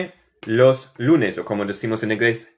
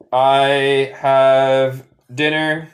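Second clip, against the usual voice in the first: very slow "I have dinner"; slow model phrase; 3 dB louder, much slower than usual. Shown as a demonstration. Teacher models the phrase slowly for students. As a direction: stretch it out for the class. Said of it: "model phrase".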